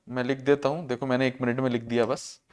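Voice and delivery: low, soft murmur while busy writing; quiet aside. Real, not acted. Teacher writing on the board for students, quiet low aside. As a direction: drop right down, half to yourself.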